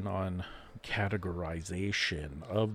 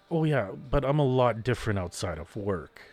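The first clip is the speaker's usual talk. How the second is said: louder mimicking of a coworker's excuse; mocking quote; 3 semitones higher, 7 dB louder than usual. Mocks what coworkers say.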